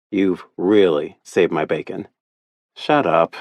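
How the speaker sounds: ordinary delivery, nothing marked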